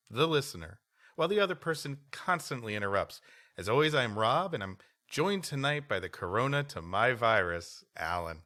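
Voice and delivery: usual speaking voice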